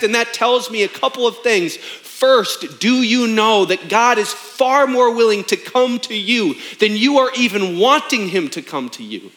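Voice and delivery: ordinary talk, nothing marked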